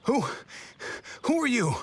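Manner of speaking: high, sharp startled question. Acted. The guard startled by newcomers, high and sharp. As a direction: jump at the stranger.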